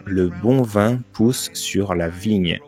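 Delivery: slow, deliberate articulation drill; "Le bon vin pousse sur la vigne", very slowly, word by word; diction exercise. Retold or imitated. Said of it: "des cours de suppression d'accent".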